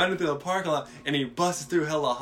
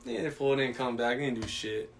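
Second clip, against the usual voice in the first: ordinary talk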